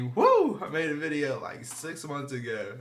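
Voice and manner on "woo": Flair woo. Real, not acted. Wrestling hype shout.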